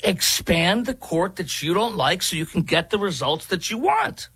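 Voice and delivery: high-pitched sarcastic gushing; mock enthusiasm. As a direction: gush in a silly high voice, mocking the idea. Mock praise of the played proposal.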